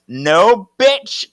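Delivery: loud, high outburst; acted angry snap, leaping up in pitch; furious retort. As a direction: throw it back hard.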